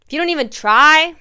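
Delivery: ordinary delivery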